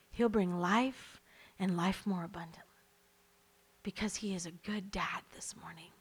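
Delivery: preacher dropping to a whisper before prayer; intimate whisper. Real, not acted. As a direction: hush it like a secret.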